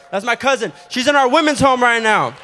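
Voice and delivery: loud proud announcement to the room; calling out. Real, not acted. To the congregation, announcing his cousin with a loud shout.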